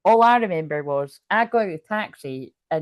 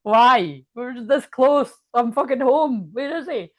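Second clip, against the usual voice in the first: louder, half-laughing mock wail; drunk crying plea; 2 semitones higher, 7 dB louder than usual. Alone at the fence, crying for him, loud mock wail. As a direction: replay the drunk sobbing at the fence, hammed-up and loud. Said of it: "so I started crying".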